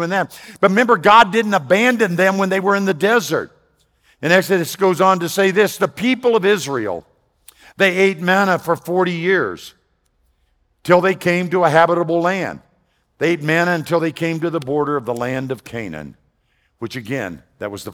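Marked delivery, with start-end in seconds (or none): 0.6-12.6 raised, ringing sermon voice; fervent pulpit delivery; preaching. Acted. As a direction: press the point on the congregation.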